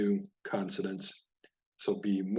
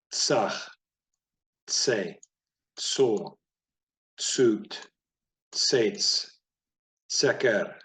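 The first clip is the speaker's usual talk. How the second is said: brisk word list, short gaps between words; picked-up drill pace; slower than usual. Shown as a demonstration. Teacher's final read-through for confident learners, brisk drill pace. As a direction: move promptly from one word to the next. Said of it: "I'm gonna really be picking up the speed here".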